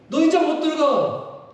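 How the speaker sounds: high, loud and slow, a flat refusal; stern God voice. Pretend. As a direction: hammer out the verdict as a stern God. God refuses Moses entry. (하나님이 모세에게 단호하게 거절하며 높고 크게)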